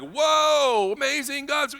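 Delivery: high, excited cheer; mock celebration. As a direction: burst out like at a party. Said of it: "there's gonna be like".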